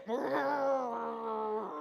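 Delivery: long low "mm"; male rage growl. Shown as a demonstration. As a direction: growl low and drawn out, like an angry man. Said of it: "if you're a male".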